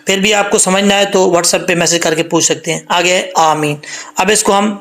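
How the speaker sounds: normal talking voice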